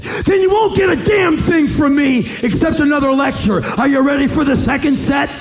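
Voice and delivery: high, loud shouted lecture; loud threat. Retold or imitated. Threatening the boy with another lecture, shouting high. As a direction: bark it like a coach.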